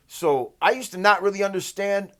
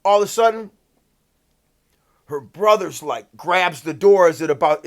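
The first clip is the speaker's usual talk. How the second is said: usual speaking voice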